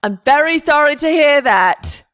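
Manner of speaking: loud, high mock condolence; hammed-up forced politeness; forced sympathy. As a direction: imitate a dutiful, insincere apology. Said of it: "you say it in this forced way".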